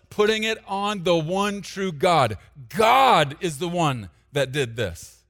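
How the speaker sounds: slow, loud preacher's build to a shout; preaching at full force. Acted. Pastor preaching to the church, building to a loud shout. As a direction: drive the glory home to the room.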